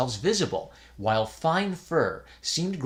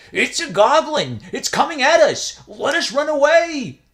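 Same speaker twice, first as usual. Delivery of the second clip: put-on high, loud panicked young voice; terrified scream; 13 semitones higher, 9 dB louder than usual. A narrator's reading. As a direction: scream and flee. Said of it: "and screamed".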